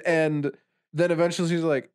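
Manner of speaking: ordinary talk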